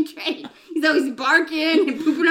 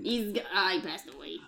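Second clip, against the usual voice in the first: mock sad; 8 semitones lower, 13 dB quieter than usual. Sad news, crying heard.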